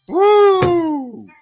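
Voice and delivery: high, sustained whoop; mock falling noise, held high. Everyone tumbles from the cut net, high whoop.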